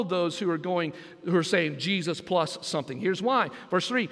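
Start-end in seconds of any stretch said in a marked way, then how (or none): none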